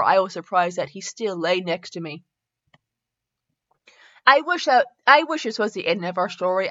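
measured, earnest read-aloud voice; story narration. Acted. Reading fan fiction aloud to listeners, story narration. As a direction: read the romance out evenly and sincerely.

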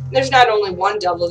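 ordinary delivery

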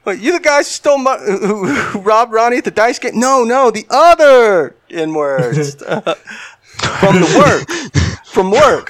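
high, loud accusing; hammed-up milkman; mock milkman confusion. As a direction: call them out. Milkman confronts wrong people.

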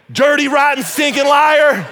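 high, full-volume hammed-up denunciation; mock accusing shout. Preacher mock-denouncing Pastor Craig, hammed-up accusing shout. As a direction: shout it out, dragging the final words in mock outrage.

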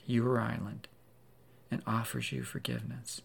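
usual speaking voice

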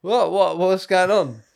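feigned obliviousness. Retold or imitated. Caught nose-picker pretends not to hear, mock innocence. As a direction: act as if you missed it all. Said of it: "pretended that I didn't hear 'em".

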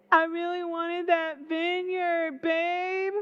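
high, slow pouty whine; hammed-up spoiled brat sulking to his wife; whining. Sulking king whines to his wife because he can't have the vineyard. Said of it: "in his silk pajamas whining".

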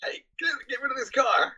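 high mock yell at a driver; mock shout. Angry homeowner yells at George, high mock shout. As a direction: yell it up high, half joking. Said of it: "guy keeps shouting at him".

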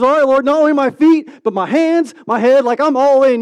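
high, loud, over-eager outburst; over-eager Peter. Acted. Disciple begging Jesus to wash all of him, over-eager. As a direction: blurt it out loudly, all in. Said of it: "being who Peter is".

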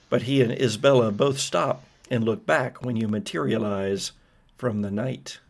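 ordinary talk

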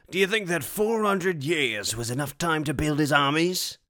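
wry warrior quip. Acted. Barahir quipping, higher.

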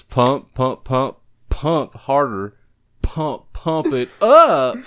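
slow, punched "pump" building to a loud "up"; thrusting harder. Acted. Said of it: "That's me thrusting harder".

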